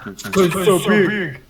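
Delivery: slow, laughing mock boast; laughing brag. Real, not acted. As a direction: boast slowly while cracking up. Laughing joke.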